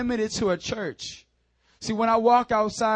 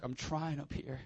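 ordinary talk, nothing marked

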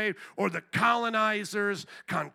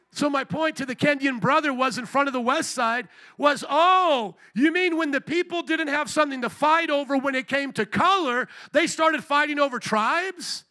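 loud, high preaching voice; preacher retelling his point to the crowd; full-force preaching; 5 semitones higher, 6 dB louder than usual. Acted. Loud, raised preaching voice.

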